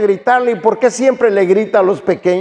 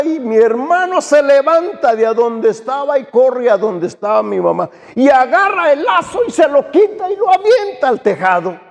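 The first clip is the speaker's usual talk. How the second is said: ordinary delivery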